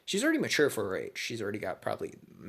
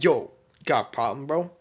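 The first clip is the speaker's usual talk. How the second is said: louder, rising yo got a problem; mock tough challenge; 4 semitones higher, 10 dB louder than usual. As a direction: square up like a hallway bully. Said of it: "And he's like".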